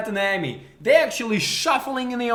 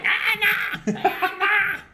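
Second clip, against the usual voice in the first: mock scream; 12 semitones higher, much slower than usual. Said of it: "because you've been screaming".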